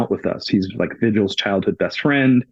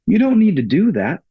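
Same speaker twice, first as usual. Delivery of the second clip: higher, pressing plea to stop; telling the firefighters with the saw to stop cutting, urgent; urgent protest; 9 semitones higher, 3 dB louder than usual. Retold or imitated. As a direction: push back with alarm in your voice. Urging rescuers not to cut.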